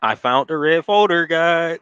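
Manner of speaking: high and loud, gleeful discovery; mock excited prosecutor. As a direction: gloat like you just found evidence. Mocking prosecutor finding evidence.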